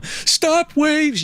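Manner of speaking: high, impassioned re-enacted holler; acted crying yell. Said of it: "and then I'm crying and I'm".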